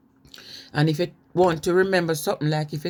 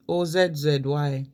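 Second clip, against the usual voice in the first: ordinary talk, nothing marked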